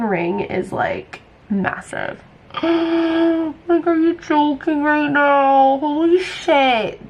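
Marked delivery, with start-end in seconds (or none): 2.5-3.6 a high, sharp gasp of shock. Real, not acted. Shocked by engagement news. 3.7-7.1 higher, slow, drawn-out disbelief; stunned disbelief. Real, not acted. Shocked by engagement news.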